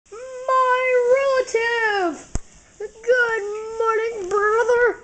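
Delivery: high, slow joyful call; excited greeting. Pretend. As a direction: greet him like a long-lost brother. Greeting a toy car.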